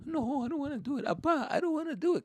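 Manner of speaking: high, small whiny voice; scared pleading child. Acted. Kid at front of line to dad, mock whiny pleading. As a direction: whine like a frightened little kid.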